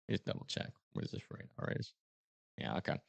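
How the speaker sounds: whispered check to himself; whispering while rechecking radar; whispered aside. Real, not acted. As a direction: whisper it under your breath. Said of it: "muttering to self".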